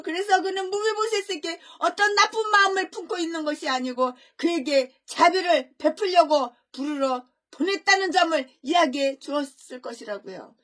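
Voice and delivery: ordinary talk, nothing marked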